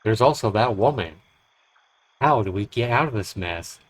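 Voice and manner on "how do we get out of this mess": ordinary delivery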